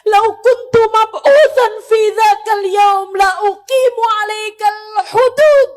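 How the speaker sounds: loud, high Arabic quotation in anguish; tearful proclamation. Retold or imitated. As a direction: cry out the verdict at full force. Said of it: "Nabi Muhammad mengatakan". (seruan lantang penuh tangis)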